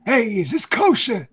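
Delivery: put-on high, loud, slow complaining old man at a deli. Shown as a demonstration. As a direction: kvetch about the food like a grumpy old man.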